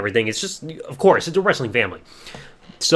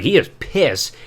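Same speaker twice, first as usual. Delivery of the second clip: ordinary talk, nothing marked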